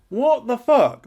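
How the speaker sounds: loud, high cry of disbelief; shocked outburst. Real, not acted. Reacting to sex in the living room, loud and high. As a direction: blurt it out in shock.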